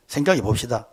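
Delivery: ordinary talk, nothing marked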